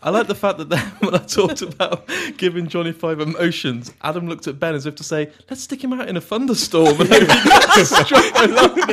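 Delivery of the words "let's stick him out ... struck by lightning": mock scheming. Imagined quote of friend.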